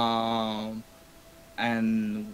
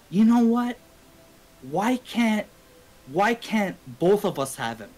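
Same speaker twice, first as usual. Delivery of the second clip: higher-pitched imitation of a girl making a bold pitch; retold girl's proposal; 7 semitones higher, 3 dB louder than usual. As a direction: mimic her, pitched up on the opening.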